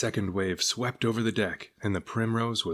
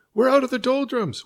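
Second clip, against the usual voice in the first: much higher, louder joyful shout; crowd shouting; 8 dB louder than usual. A narrator's reading. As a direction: cheer as the wind returns. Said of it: "with everyone shouting".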